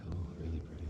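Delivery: hushed whisper at a quiet lake; wildlife watching whisper. Real, not acted. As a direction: whisper so the bears won't hear. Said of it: "I feel like I gotta whisper here".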